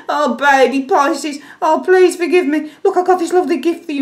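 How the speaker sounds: high, sugary begging, rushing at the end; mock-sweet apology with tail between legs; groveling lover. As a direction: wheedle and offer a gift.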